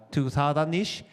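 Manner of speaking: ordinary talk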